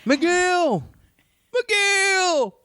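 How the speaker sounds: mock shouted call, very high. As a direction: call the name out across the forest. Drunk man calling his own name.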